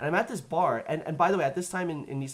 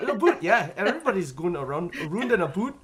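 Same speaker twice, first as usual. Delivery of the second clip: mock Canadian accent, higher and louder; 3 semitones higher, 6 dB louder than usual. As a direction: exaggerate Canadian vowels, turning about into a boot. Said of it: "had no idea Canadians had an accent".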